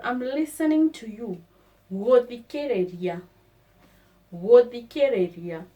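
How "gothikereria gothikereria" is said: Gothikereria said loud, go-thee-keh-reh-ree-ah; Kikuyu word. Shown as a demonstration. Teacher drills Gothikereria for learners, loud. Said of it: "to listen".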